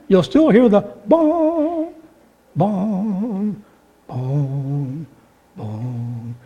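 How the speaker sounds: a bell ringing out into silence; fading bell. Shown as a demonstration. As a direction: let the bell die away. Bell sound fading away.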